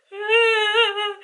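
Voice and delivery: real high burst of laughter. Real, not acted. Laughing at own story.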